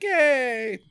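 high, drawn-out little cheer; mock cheer. Everybody cheering after the chip, high mock cheer. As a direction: cheer it high for a whole crowd. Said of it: "and everybody's like".

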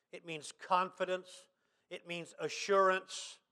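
soft, low, confiding sermon voice; hushed preaching. Real, not acted. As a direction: bring the sermon right down, low and gentle. Preacher drops to hushed delivery.

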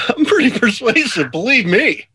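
high, teasing parrot of the boast; mock-smug repeat; mocking echo. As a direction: mimic him with a sneer. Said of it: "Oh, no, you're not".

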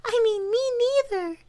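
very high, halting mouse squeak; tiny timid creature voice; tiny little voice. A narrator's reading. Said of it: "said a tiny little voice".

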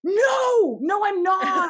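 very high, shouted mock panic; panicked denial. Flustered actor denying being British to director, panicked. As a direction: squeal the denial at top volume.

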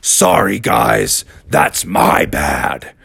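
big dinosaur voice dropping very low; slow, deep oafish apology; sheepish T-Rex. Acted. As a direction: apologise as a huge slow beast.

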